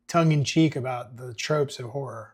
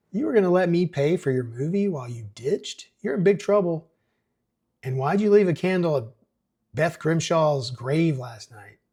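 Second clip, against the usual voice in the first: angry mayor; 4 semitones higher, 5 dB louder than usual. Acted. Mayor scolds teens.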